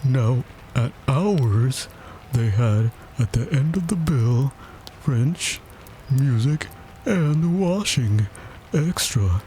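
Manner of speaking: high, drawn-out listing with pauses; pompous, doleful turtle; self-important Mock Turtle. A narrator's reading. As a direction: list each subject slowly and solemnly. Mock Turtle lists school extras.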